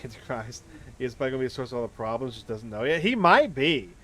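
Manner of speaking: normal talking voice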